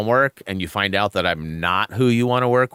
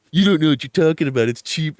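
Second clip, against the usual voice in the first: quick, raised-pitch rebuttal; mock lecturing speech; 5 semitones higher, 5 dB louder than usual. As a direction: argue back, imitating a know-it-all kid. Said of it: "gave me that whole speech".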